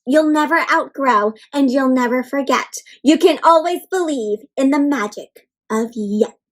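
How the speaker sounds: ordinary delivery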